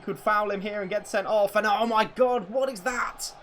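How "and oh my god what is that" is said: high, disbelieving shout; stunned gamer yelling at the screen; shocked outcry. Real, not acted. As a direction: react like you can't believe what you saw. Shocked at a play.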